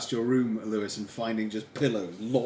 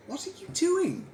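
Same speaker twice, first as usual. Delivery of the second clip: high, puzzled imitation of his wife; a husband mimics his sleepwalking wife's confused question. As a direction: pitch it way up for the half-asleep wife. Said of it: "she says".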